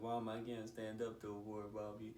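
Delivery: plaintive retold child voice; whining child. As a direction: imitate a worn-out kid whining. Child self, tired, pleading to mom.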